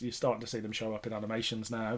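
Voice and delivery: normal talking voice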